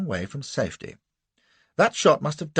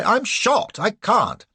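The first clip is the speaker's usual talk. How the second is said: pained cry; 11 semitones higher, 5 dB louder, much slower than usual. A narrator's reading. Wounded man crying out.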